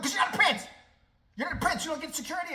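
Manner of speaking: loud, high mocking yell; yelling at him. Real, not acted. Ranting at the prince, loud and high. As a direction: shout it right at him.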